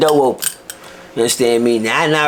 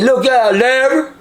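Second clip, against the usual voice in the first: calling out; 9 semitones higher, slower than usual. Retold or imitated. Inmate calling across cells.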